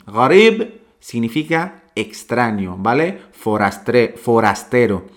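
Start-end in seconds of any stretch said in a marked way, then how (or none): none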